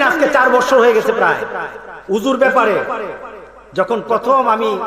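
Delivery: ordinary delivery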